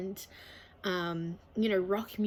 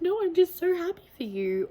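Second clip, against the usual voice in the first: much higher and louder, gushing with happy emotion; overjoyed reply; 10 semitones higher, 7 dB louder than usual. Retold or imitated. To friends sharing good news, a high, loud, gushing happy reply. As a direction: burst out high and loud, brimming with joy. Retelling friends asking if she's okay.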